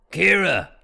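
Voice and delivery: loud, high call across a room. Acted. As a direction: call her name over the tavern noise. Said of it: "Talbot called from across the room".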